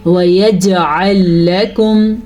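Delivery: وَيَجْعَلْ لَكُمْ recited slowly with a bounce on the ج; slow tajweed recitation, bounced ج in وَيَجْعَلْ; qalqalah on jeem. Shown as a demonstration. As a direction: echo the ج in وَيَجْعَلْ. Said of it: "جیم پہ قلقلہ ہو رہا ہے".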